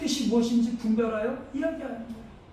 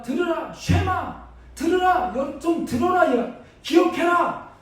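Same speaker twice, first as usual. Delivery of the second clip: higher, insistent call building to a slow shout; urgent divine summons; 6 semitones higher, slower than usual. Acted. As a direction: call them to listen at last. God pleading with Israel.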